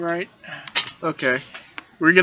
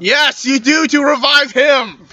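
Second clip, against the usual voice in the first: insistent; 6 semitones higher, 4 dB louder, much slower than usual. Real, not acted. Urging friend to revive him.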